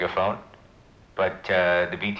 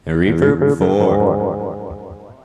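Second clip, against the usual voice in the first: echoing reverb voice; heavy reverb, slow; reverb effect; 9 dB louder, much slower than usual. Shown as a demonstration. Demoing voice effect.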